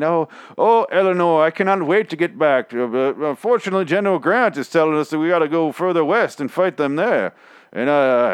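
lightly voiced, parody of a sappy Civil War letter; mock soldier's letter. Soldier writing to his wife from the war, mock sentimental.

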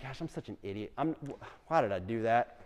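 hushed, low inner monologue; very soft, low aside to self; muttered self-reproach. Retold or imitated. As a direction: mutter it to yourself, acting out regret. Acting out his regretful inner thought.

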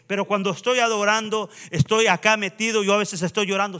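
impassioned sermon, driving and emphatic; fervent preaching. Real, not acted. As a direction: exhort the congregation with full force. Exhorts the congregation to worship.

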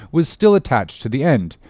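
ordinary delivery, nothing marked

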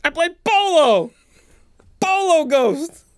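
high, loud, drawn-out boast; hammed-up high holler; mock hero shout. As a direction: proclaim it as a cartoon hero. Acted answer as polo-playing hero.

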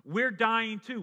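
loud, high, quick "We're dying too"; rebuking criminal. Acted. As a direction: snap it back at him. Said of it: "the other says".

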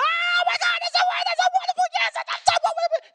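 shrieking with joy, very high; gushing falsetto celebration; ecstatic fan. Retold or imitated. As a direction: squeal like a thrilled fan. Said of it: "they're gonna be like".

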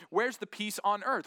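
ordinary talk

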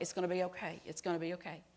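put-on soft, soothing reassurance to a hurt child; comforting stranger. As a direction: hush her gently while holding her. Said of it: "just stroked my back".

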